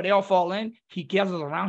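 usual speaking voice

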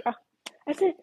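usual speaking voice

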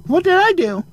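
high, fake-innocent whimper; high mocking "what did I do"; mock innocent whine. Mocking passive-aggressive person.

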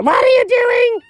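very high shrieked cry; startled shriek. Acted. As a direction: shriek in alarm. Startled by dog singing.

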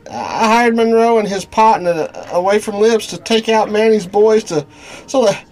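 nervous stammering. Acted.

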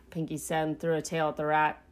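plain reading voice

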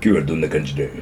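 deep growling Otomo impression; [name] voice. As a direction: drop way down into a husky singer impression. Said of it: "そんな渋い声でしたっけ？".

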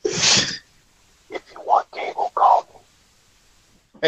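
unvoiced, hushed secret offer; whispery Bob Ross voice. Host slips a cable-theft offer to listeners, whispered. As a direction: whisper it on the sly. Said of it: "Don't start talking like Bob now".

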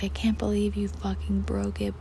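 ordinary talk, nothing marked

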